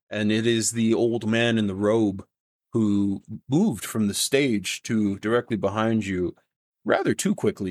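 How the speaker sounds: usual speaking voice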